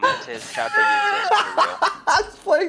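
high squeaky laugh through words, slow; giggling so hard it nearly cries. Real, not acted. Laughing while talking to girlfriend.

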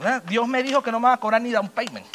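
normal talking voice

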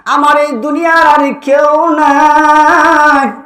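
loud, high, long-held wail; acted belted lament, stretching the final word; crying out. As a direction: cry it out as a desolate mother in full sung chant. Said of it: "মা ডাক দিয়া বলে".

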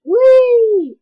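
loud, high whee. Real, not acted. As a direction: shriek a gleeful whee. Character falling down stairs.

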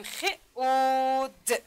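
خ، او، د as three sounds, او drawn out; slow letter-by-letter خ، او، د; sounding out. Shown as a demonstration. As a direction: hold او long between خ and د. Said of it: "اگر این را صدا کشی کنیم".

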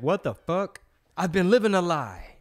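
hammed-up dismay; mock outrage. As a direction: exclaim as if betrayed by a shocking discovery. Acts out outrage at body fat number.

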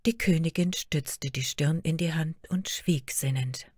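usual speaking voice

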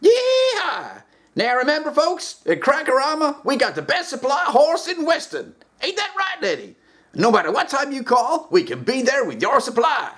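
high, hammy cowboy pitch; yee-haw salesman selling hard; high hammy cowboy huckster. Acted. Dealer ad, cowboy voice.

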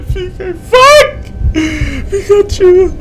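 high, tearful half-laughing cries; panicked squealing. Real, not acted. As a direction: squeal the comfort word in real panic. Panicking, cries scared catchphrase.

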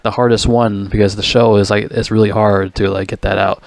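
normal talking voice

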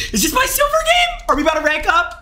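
high-pitched excited shouting; screamed excitement. Real, not acted. Streamer asking chat about ranking up, screamed excitement. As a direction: squeal the question out in disbelief. Realizes a rank-up game.

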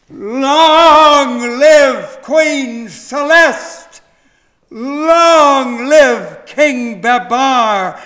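loud, slow triumphant cheer; crowd shouting. A narrator's reading. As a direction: shout it out with one voice. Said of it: "the elephants shouted".

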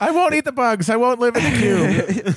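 high, laughing mock conspiracy whine; mock protesting kid. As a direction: parody a tiny protester, half laughing. Mocking conspiracy kid's protest.

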